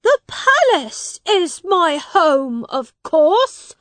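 put-on high, loud, slow indignant young prince; a boy king insisting on his palace; shouting. A narrator's reading. Edward shouts at Miles that the palace is home. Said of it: "shouted Edward".

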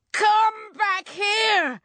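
high, loud, drawn-out yell; angry yell. A narrator's reading. Farmer chasing the witch, angry yell. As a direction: yell after someone escaping. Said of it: "he yelled".

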